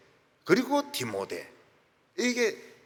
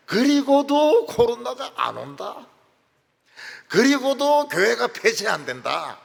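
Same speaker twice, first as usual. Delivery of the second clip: emphatic preaching; 7 semitones higher, 6 dB louder than usual. Acted.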